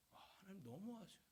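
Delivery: soft, deflated acted reproach; hurt reproach. Job facing God's silence, quietly hurt reproach. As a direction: let it out quietly, let down.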